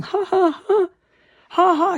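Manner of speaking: high, loud taunting laughter; mimicking the couple laughing at him; mocking laugh. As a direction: imitate their cruel laughter. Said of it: "they were both laughing".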